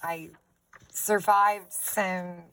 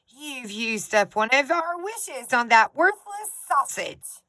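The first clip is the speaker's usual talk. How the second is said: slow, higher, scolding complaint; angry wife; 6 semitones higher, 6 dB louder than usual. Acted. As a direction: scold the husband over the wasted wish. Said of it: "she cried".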